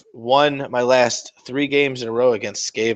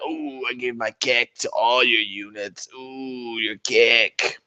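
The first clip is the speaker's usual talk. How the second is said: put-on soft, slow leering old man; dirty old grandpa voice; 5 dB quieter, much slower than usual. Opponent gloating over units: dirty old grandpa voice. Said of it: "old grandpa voice".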